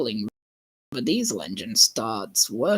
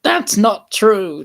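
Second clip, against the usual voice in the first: louder, slow, firm denial; loud protest; 6 semitones higher, 9 dB louder, slower than usual. A narrator's reading. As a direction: push back hard and slow. Tom retorts to Diesel.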